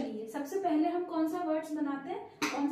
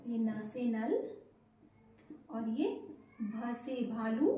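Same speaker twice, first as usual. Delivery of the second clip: slow, lower naming of the letter; slow spelling; 4 semitones lower, 4 dB quieter, slower than usual. Shown as a demonstration. Teacher slowly naming the letter भ for children. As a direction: spell it out gently for little kids. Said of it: "देखिए".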